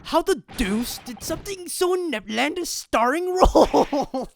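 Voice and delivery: loud, slow, outraged character voice; outraged shout. Acted. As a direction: bellow it slowly in a put-on voice. Voicing an outraged game character.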